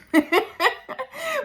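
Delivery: high-pitched burst of laughter; amused laugh. Real, not acted. Laughs at not reaching them.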